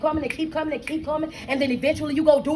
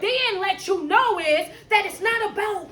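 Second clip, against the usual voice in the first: higher-pitched, driving sermon delivery; fervent preaching; 4 semitones higher than usual. Real, not acted. Coach preaching that life requires a brand new you, fervent.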